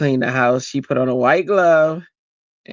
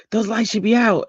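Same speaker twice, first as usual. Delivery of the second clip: imitated nagging mother; 8 semitones higher than usual. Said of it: "She'd be like".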